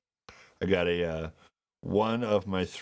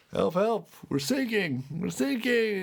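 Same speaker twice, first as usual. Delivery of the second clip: high, mock-panicked cry for help; hammed-up high plea; panicked Mayday call; 12 semitones higher, slower than usual. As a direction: play a frantic distress call for a joke. Acting out a Mayday call in joke.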